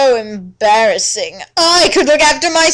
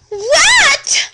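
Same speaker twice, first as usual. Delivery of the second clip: high, stunned outburst; a girl stung by a cruel question, crying out; shocked cry; 4 dB louder than usual. Acted. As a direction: yelp it in hurt disbelief. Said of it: "I cry".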